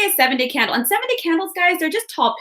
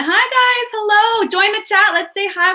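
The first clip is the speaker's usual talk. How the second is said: excited greeting; 10 semitones higher, 3 dB louder than usual. Real, not acted. Greeting viewers joining live.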